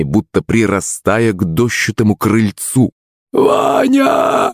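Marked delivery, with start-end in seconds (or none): 3.3-4.5 high wild shriek of a name; screaming in fear. Acted. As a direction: shriek it in panic. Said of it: "дикий вопль маман".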